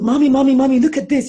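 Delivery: put-on high, loud eager kid calling for mom; a four-year-old showing off a find; excited little child.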